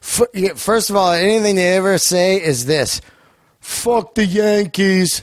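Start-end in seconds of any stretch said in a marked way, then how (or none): 3.6-5.2 slow, drawn-out Boston sports fan; Boston accent. Retold or imitated. A Boston local grumbling about the Yankees in a thick Boston accent.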